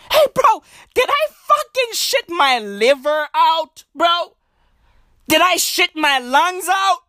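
high-pitched, hammed-up disbelief; mock horror. Acts out horror at the toilet.

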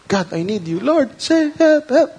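pleading prayer. Retold or imitated. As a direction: beg God for help in a crisis. Voicing a desperate prayer.

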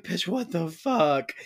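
high, drawn-out mock freak-out; slow, high-pitched acted disbelief. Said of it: "freaks out".

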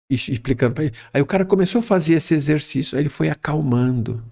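ordinary talk, nothing marked

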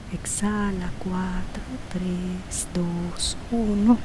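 soft, slow counting with whispered exhales; slow breath counting. Acted. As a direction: count each breath gently and unhurried.

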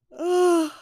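yawning through the word. Real, not acted. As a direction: let a big yawn out. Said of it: "I yawned again".

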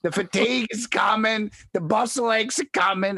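high-pitched hammed-up panic; mock panic. Friend itching over side effects on the drive, mock panic. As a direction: imitate a friend working himself into hysteria.

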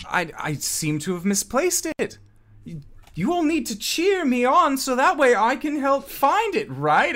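high, theatrical bluffing voice; showman manager, flustered. Acted. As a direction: cover the slip by rallying the crowd. Flustered manager covers lost item.

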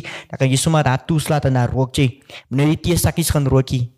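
normal talking voice

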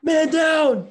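shouted high, loud and sudden; mock alarm. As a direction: call it out like a soldier hit. Podcaster falls, calling out.

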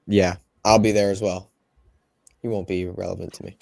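ordinary talk, nothing marked

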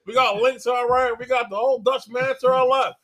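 host a pretend live interview; live show host. Pretends a live interview with Jim Ross.

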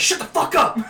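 high, hammed-up outburst; mock angry shout. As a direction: cut them off with a mock yell. Officer cuts off arrested woman.

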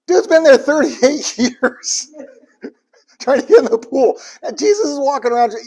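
high, loud, laughing through the words; laughing in disbelief; amused laughter while telling. Real, not acted. Laughs at the absurd story.

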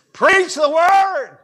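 high, loud, drawn-out shout; full-force preaching. Shown as a demonstration. Preacher showing how preach must be said, full-force shout. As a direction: cry it out like a pulpit command. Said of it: "I can't say it lightly".

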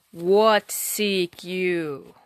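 slow booming giant demand; voice of thunder. Acted. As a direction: draw it out like a giant. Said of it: "he demanded in a voice of thunder".

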